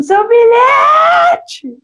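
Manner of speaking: high, loud, drawn-out yell; shouting after someone. Retold or imitated. Woman shouting after girl about her ticket. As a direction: call out across the street. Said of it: "e ela gritando".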